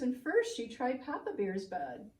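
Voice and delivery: ordinary delivery, nothing marked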